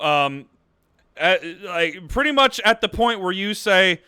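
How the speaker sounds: normal talking voice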